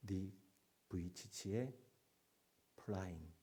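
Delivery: usual speaking voice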